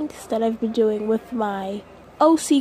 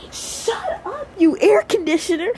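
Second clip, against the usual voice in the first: high then louder, irritated; annoyed snap; 8 semitones higher, 4 dB louder than usual. Real, not acted. At the air conditioner ruining the take, an annoyed snap. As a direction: snap at the noisy machine.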